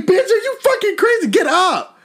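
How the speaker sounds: high laughing mock yell; laughing retold yell at a friend; yelling at her. Yelling at drowning girl.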